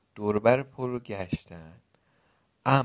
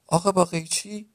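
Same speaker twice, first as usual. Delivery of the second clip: higher-pitched insistent yell, not full volume; mild narrated shout; 6 semitones higher, 3 dB louder than usual. A narrator's reading. Brother yelling across the river in their argument, raised mild shout. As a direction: call it out in exasperation, pitched up. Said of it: "فریاد زد".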